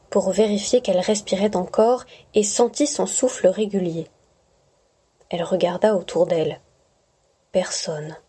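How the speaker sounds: ordinary delivery, nothing marked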